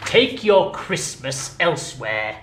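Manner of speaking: loud, slow, cold; curt dismissal. Acted. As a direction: show him the door. Scrooge dismisses nephew.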